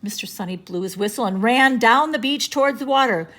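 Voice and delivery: plain reading voice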